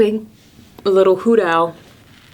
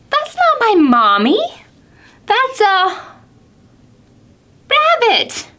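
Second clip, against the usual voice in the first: high disappointed owlet; a little owl let down again; 9 semitones higher than usual. Acted. Owlet rejecting the rabbit as mommy, high and let down.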